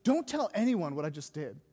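low, acted stern warning. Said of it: "He says it really sternly".

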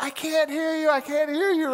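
can't-hear shout. Retold or imitated. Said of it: "my buddy across the table is like".

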